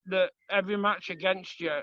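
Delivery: ordinary talk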